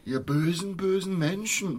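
high, slow mock horror; mock outrage. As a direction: ham up shocked indignation. Echoing the actor's mock outrage.

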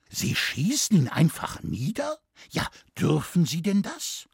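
high-pitched, incredulous scholar; shocked disbelief. Acted. Scholar questions forester about shooting thieves, shocked. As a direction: question it in rising alarm.

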